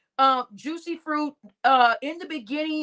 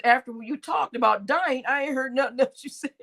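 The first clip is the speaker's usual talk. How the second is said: laughing. Real, not acted. Rereading a viewer's joke.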